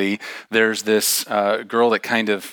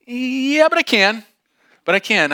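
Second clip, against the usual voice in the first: stubborn refusal; 13 semitones higher, 4 dB louder than usual. Retold or imitated. Girl to the group leader, stubborn refusal. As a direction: counter it in a pitched-up, obstinate voice.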